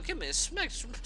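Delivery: plain reading voice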